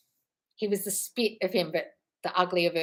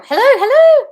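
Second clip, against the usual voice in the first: very high, loud hammed-up hello; excited greeting; 17 dB louder than usual. Host acting out viewer's greeting, hammed-up excitement. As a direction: trill it out loudly.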